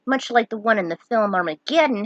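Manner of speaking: ordinary delivery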